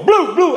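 high, bouncy thudding syllables; imitated bump noise. Narrator imitating the truck hitting the speed bump, imitated noise. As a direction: sound out two bumps, pitched high.

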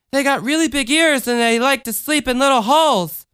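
put-on high childlike chatter; little girl voice. A narrator's reading. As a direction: speak up like a small girl. Michelle speaks.

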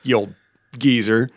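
put-on low-pitched, teasing old-pal voice; joshing commander. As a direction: rib a friend as the commander would. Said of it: "As, like, a little joshing around".

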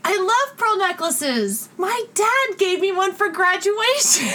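sweetly oblivious put-on voice; naive roommate.

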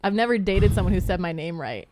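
ordinary talk

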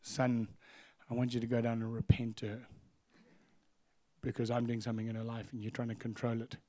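low, soft, measured retold command; quiet, unhurried divine instruction; voice of God. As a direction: calmly correct him like a father. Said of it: "And the Lord said to me".

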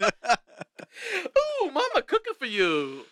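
hammed-up Italian accent; a cartoonish Italian mama voice; mock Italian. Podcast host voicing a silly ad, mock Italian accent. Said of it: "we're doing Italian voices here".

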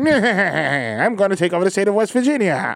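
slow hammy cartoon villain voice; drawn-out mustache-twirling villain; Dick Dastardly voice. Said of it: "sound like Dick Dastardly".

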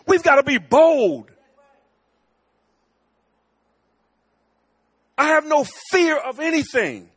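high, loud full-force preaching; rally the family with bold preaching. Acted. Pastor rallying his church family, preaching at full force.

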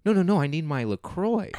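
high, whining mock of the office women; whiny coworkers. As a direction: pitch it up like a pouty coworker. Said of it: "these women were like".

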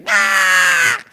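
high loud exasperated shriek; frazzled mum scream. Retold or imitated. Mother at five o'clock cooking tea shrieks at kids. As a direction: shriek like a mum at the end of her rope. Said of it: "if she goes".

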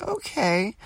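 high little retold "okay"; mock meek compliance. Group answers the scolding waitress, mock meek.

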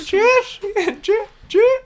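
sleazy cartoon creep; creepy pervert voice. Pervert voice continues.

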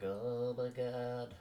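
slow, awed stoner voice; drawn-out dazed wonder; stoned awe. Retold or imitated. Stoned listener in bed.

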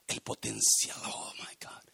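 whispered in awe; awed whisper. Real, not acted. Pastor overwhelmed by the seed's potential, awed whisper. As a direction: breathe it out, overcome.

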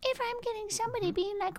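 put-on high, soft, tiny baby-talk voice. Shown as a demonstration. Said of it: "I want your baby voice".

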